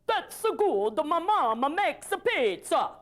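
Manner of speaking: put-on cheesy Italian accent; hammed-up Mario-style Italian accent. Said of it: "who voiced Mario now".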